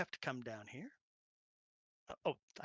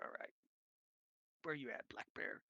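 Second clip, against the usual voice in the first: ordinary talk, nothing marked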